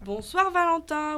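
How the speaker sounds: salut fort, lent et aigu de lutins; salut en chœur. A narrator's reading. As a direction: lancer le salut à pleine voix, en traînant, comme toute une bande. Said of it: "reprirent en chœur l'elfe et les lutins". (chœur de lutins, fort et traînant)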